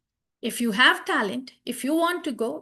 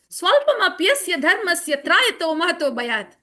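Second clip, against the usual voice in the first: louder spoken Sanskrit verse, no chant; emphatic quoting; 3 semitones higher, 5 dB louder than usual. Acted. Devotee challenges friends about movies, loud emphatic recital. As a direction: fire the verse at them forcefully. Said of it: "you should go".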